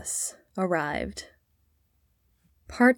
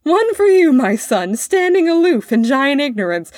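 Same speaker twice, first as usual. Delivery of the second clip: loud, high, jeering roar; roaring with laughter; 7 semitones higher, 7 dB louder than usual. A narrator's reading. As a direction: throw the verse back at him in noisy mockery.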